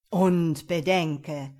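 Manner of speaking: slow, loud, insistent devil; urgent warning. A narrator's reading. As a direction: warn with heavy weight. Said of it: "mahnte das Feuerwesen eindringlich".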